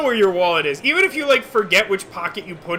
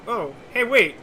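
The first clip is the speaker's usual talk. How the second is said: ordinary delivery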